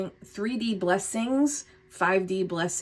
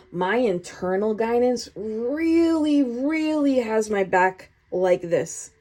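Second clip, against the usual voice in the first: both words "really" stretched out slowly and pitched up; emphatic REALLY, REALLY; 3 semitones higher, slower than usual. Acted. Reader voicing the viewer's realisation, each "really" drawn out emphatically. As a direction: draw out each "really" with conviction.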